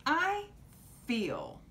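"I" very high, "feel" falling; singsong "I feel". Shown as a demonstration. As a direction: pitch "I" way up, drop on "feel". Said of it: "Can you say that".

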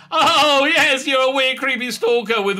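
loud, high, laughing sarcasm; mock the romance scornfully; sarcastic mock. Sarcastic jab at character.

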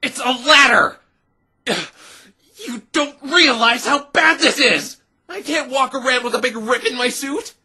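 high, loud frantic outburst. Acted. Snapping at her friend over the ripped suit, frantic. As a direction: panic about the ruined suit.

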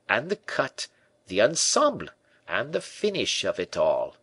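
proud tailor in high triumph; triumphant tailor. A narrator's reading. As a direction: crow over the secret only he knows. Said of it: "cried the tailor in triumph".

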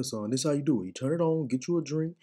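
usual speaking voice

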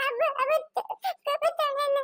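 put-on high little child's voice, tearful; a small kid sobbing out words; high, crying child complaining to grandma.

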